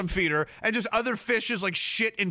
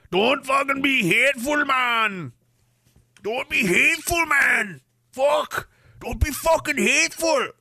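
put-on higher shouted Saskatchewan guy accent, drawn out; weird Saskatchewan guy accent; 6 semitones higher, slower than usual. Streamer reading a chat message, in a Saskatchewan guy accent. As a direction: yell it like a rowdy Saskatchewan guy. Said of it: "I've almost got a weird Saskatchewan guy accent".

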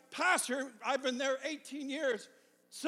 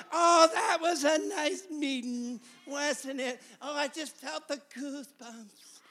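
mock churchgoer; 3 semitones higher, 6 dB louder, slower than usual. Preacher mocking people who only come for goosebumps, gushing. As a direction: mimic a swooning, satisfied churchgoer. Mimicking complacent churchgoers after a meeting.

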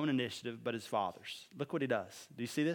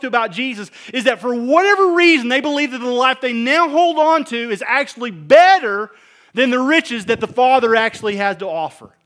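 loud, rising sermon appeal; full-force preaching; 7 semitones higher, 12 dB louder than usual. Acted. Preacher pleads with the congregation to follow Christ, loud. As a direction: pour it out over the room.

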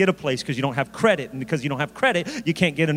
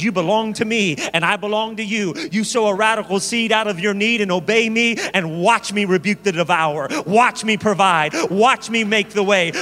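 louder, higher, emphatic preaching as God; 4 semitones higher, 5 dB louder than usual. Acted. Preacher voicing God's promise to the believer, forceful declaration. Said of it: "God says".

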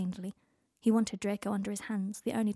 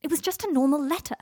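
yelling; 6 semitones higher, 7 dB louder than usual. A narrator's reading. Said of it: "Harry yelled".

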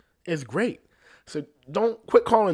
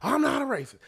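pitched way up, defensive and indignant; 11 semitones higher, faster than usual. Retold or imitated. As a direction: squeal the denial like you've been caught. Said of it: "they start freaking out".

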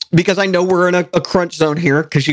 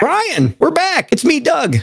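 high, sing-song show opener; hammed-up host intro; 12 semitones higher, slower than usual. Podcast host greeting listeners in a mock announcer voice. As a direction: announce yourselves like cheesy radio hosts.

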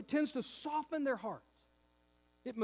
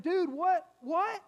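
pitched-up disbelieving what, what; incredulous what; 8 semitones higher, 4 dB louder, much slower than usual. Retold or imitated. Pastor to a flirting fiancé, incredulous. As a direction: ask it in sheer disbelief. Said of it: "And so I said".

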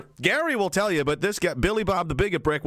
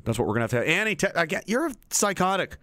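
normal talking voice

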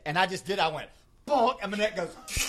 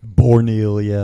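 announcer boom; 6 semitones lower, 18 dB louder, slower than usual. Retold or imitated. Calling out the town name.

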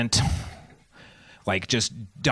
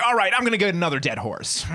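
fussing mom; 7 semitones higher, faster than usual. Acted. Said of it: "Mom's just like".

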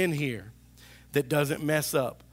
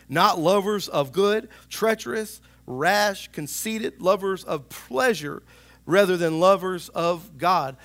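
louder, slower, higher scripture reading; building preacher's cry; preaching scripture; 3 semitones higher, 7 dB louder than usual. Acted. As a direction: preach it out, pushing each phrase. Preaching scripture list at force.

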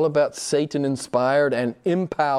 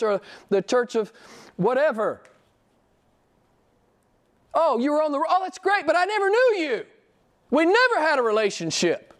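voicing Jesus; 9 semitones higher than usual. Preacher voices Jesus rejecting churchgoers who never knew him. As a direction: speak as Jesus turning away the merely religious. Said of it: "Jesus says".

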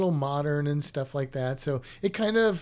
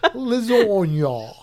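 drawn-out, higher mock Italian accent; 4 semitones higher, 4 dB louder, much slower than usual. Host teasing co-host over the accent, exaggerated Italian accent. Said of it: "Where did that accent go?".

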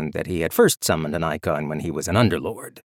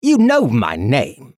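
slow, louder indignant protest; 4 semitones higher, 7 dB louder, slower than usual. A narrator's reading. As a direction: object with wounded pride. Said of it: "Ethan protested".

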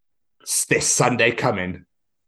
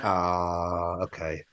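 much softer, lower "okay"; deflated; 5 semitones lower, 8 dB quieter, much slower than usual. Pretend. New Zealand fans answering the taunt, soft and deflated. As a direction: let it sink, deflated. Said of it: "They're like".

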